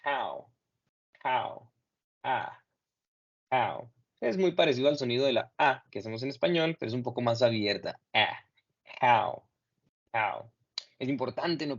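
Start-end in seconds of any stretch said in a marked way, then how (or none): 0.0-3.9 How said with an open A-like vowel, not O; vowel demo. Shown as a demonstration. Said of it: "la vocal O va a ser el sonido de A". 9.0-10.5 How said with an open A-like vowel, not O; vowel demo. Shown as a demonstration. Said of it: "vamos a tratar de pronunciarlo con el sonido A".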